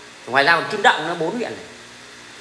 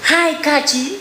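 high, slow mocking singsong taunt; exaggerated imitation of a young challenger; mimicking kid commenter; 6 semitones higher, much slower than usual. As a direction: parody a cocky kid, dragging out the question. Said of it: "tôi nhìn avatar trẻ con".

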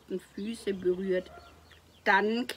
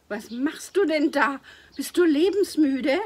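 high, loud, alarmed telling-off; alarmed scolding shout; 8 semitones higher, 10 dB louder than usual. A narrator's reading. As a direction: shout at him in shock. Sister scolds reckless brother.

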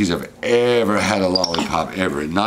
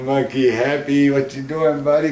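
high sing-song calling to a pet; pet talk; 6 semitones higher, 3 dB louder, slower than usual. Real, not acted. Owner calls his monkey Happy down in high pet talk. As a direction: coax the monkey down sweetly.

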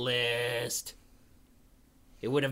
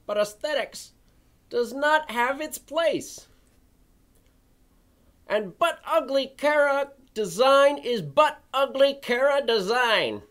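indignant rant; 11 semitones higher, 4 dB louder than usual. Acted. Ranting critic in dramatic reading.

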